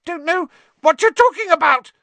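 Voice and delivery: high, squeaky, frightened rat-man; thin high whining denial; shrill Pettigrew. A narrator's reading. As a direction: squeal it out in panic. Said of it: "more shrilly than ever".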